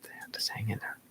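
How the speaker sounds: soft reassuring whisper. Real, not acted. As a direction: whisper it to calm the dog. Soothing dog, whispered.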